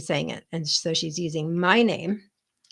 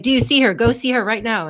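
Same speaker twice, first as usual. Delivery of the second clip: urgent; 6 semitones higher, 8 dB louder than usual. Retold or imitated. Urgent demand to dad.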